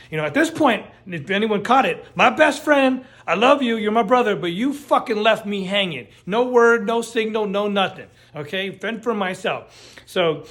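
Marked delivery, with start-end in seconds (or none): 2.1-6.8 calling out. Real, not acted. Calling out absent best friend.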